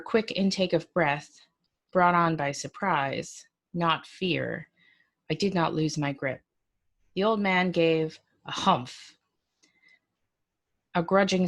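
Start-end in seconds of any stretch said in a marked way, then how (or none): none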